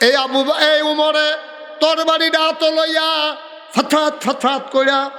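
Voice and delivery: soar into the waz melody; fervent high preaching chant; preaching at full force. Acted.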